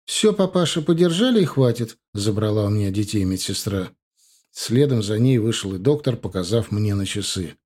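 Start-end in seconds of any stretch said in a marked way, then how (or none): 0.1-2.0 higher-pitched nurse's voice; brisk nurse. Acted. Nurse taking babies from the father, brisk nurse. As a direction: firmly wrap up the visit.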